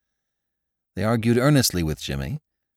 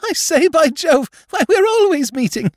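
put-on delighted young lord; 6 dB louder than usual. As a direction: savour the coincidence like a giddy toff. Lord Drever recognises Jimmy.

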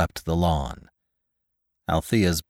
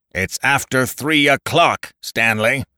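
lightly voiced loudspeaker, pitched up; dull booming words; 8 semitones higher, 5 dB louder than usual. A narrator's reading. Voice from the copters announces the time, dull boom. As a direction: call it down from overhead, raised and resonant. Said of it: "came the dull boom of words".

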